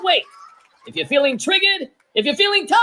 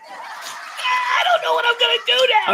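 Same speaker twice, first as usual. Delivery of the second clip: panicked wail; 7 semitones higher than usual. Acted. Panicked caller wailing to Nancy.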